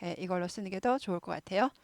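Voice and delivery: normal talking voice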